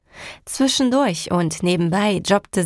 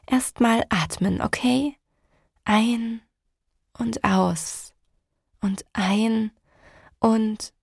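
soothing; 2 semitones higher, slower than usual. Acted. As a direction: talk her down gently. Calming cousin's breathing.

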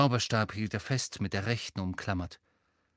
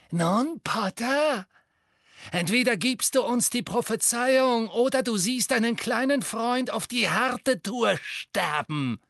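gloating witch; 10 semitones higher than usual. A narrator's reading. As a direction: relish his torment, sing-song. Bellatrix threatening Harry. (grausame, entzückte Hexe, viel höher und lauter)

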